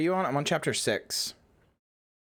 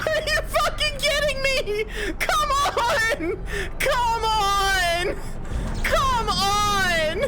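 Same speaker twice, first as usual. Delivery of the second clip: high, loud, laughing-crying yells at the game; frustrated shout; 3 dB louder, much slower than usual. Real, not acted. Gamer yelling at the game, half laughing, half crying. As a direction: scream at the screen in disbelief.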